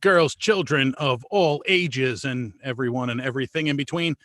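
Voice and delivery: loud showman welcome; big ringmaster opening; grand entrance announcement. Acted.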